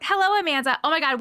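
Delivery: ordinary talk, nothing marked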